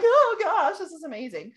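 high loud gasp then fading awe; imitating herself thrilled by a tree; excited gasp. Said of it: "I'm like".

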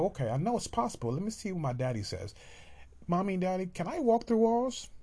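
put-on a little child testing mom and dad. Child asking mommy and daddy, very high and eager.

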